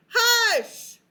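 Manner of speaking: a loud, high shout of hush. Acted. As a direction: shout it at the noisy child. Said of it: "they found themselves shouting".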